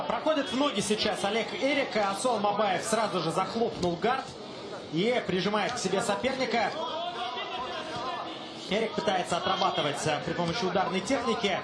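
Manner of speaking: ordinary talk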